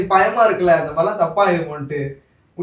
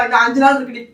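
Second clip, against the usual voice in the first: higher, louder mock of a nagging mother; imitating scolding mother; 7 semitones higher, 4 dB louder than usual. Husband imitates the wife's mother scolding her, higher and louder. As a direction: play the mother telling her off. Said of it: "அவ உன்னை தான் திட்டுவா".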